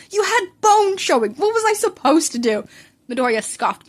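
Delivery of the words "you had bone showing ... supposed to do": indignant. Acted. Defending carrying him home.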